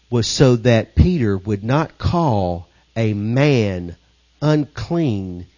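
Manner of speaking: ordinary talk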